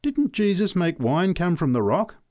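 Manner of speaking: loud, high challenge; insistent drunk heckler; demanding. A narrator's reading. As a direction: heckle the preacher with a question. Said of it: "demanded the drunk".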